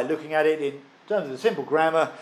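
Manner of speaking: ordinary talk, nothing marked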